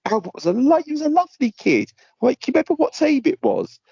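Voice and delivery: high, gushing fond-coach voice; mock nostalgia. Stringing along a scam caller, high gushing nostalgia. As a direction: fake warm memories to string him along.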